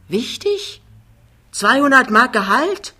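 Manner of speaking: hohe, spöttisch ungläubige Frage; ungläubig auflachen über die kleine Summe. Acted. Spöttisches Staunen über das Gehalt. (Jachmann spottet ungläubig über Pinnebergs Gehalt)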